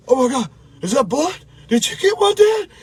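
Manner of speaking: retold excited shout of the son. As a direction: burst out like a kid who just saw blood. Son sees blood, excited call.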